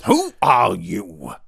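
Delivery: loud, slow bark of a thick man; bawled. A narrator's reading. Thick man bawls at the stranger, loud and slow. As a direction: bellow it at the stranger. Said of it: "bawled the thick man".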